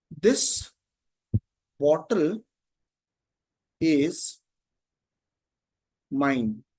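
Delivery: one word at a time, long pauses between; spaced-out words, very slow; word-by-word dictation. Shown as a demonstration. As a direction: dictate it slowly as if writing it out. Dictating while writing the example.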